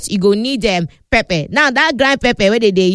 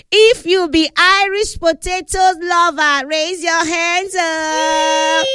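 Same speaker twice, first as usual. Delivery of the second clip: calling out to the studio; 9 semitones higher, much slower than usual. Acted.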